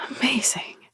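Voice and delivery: high breathy whisper of awe; breathed. Acted. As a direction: breathe it out in wonder. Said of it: "she breathed".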